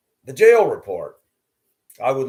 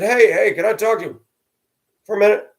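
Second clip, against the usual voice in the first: loud, high call across the yard; 10 semitones higher, 6 dB louder than usual. Retold or imitated. Calling the neighbor over about his dogs, loud high call. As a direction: raise it up to reach him.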